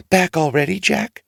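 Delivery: mother surprised at the door; lightly voiced mother, surprised. A narrator's reading. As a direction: greet him, puzzled. Mother greets Jack.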